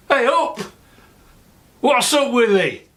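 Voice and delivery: high, bright-pitched Yorkshire "Hey up!" opening; broad Yorkshire greeting. Shown as a demonstration. Sheffield vlogger opening his channel in Yorkshire dialect. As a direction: belt out a broad Yorkshire welcome, pitched well up. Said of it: "in a Yorkshire way".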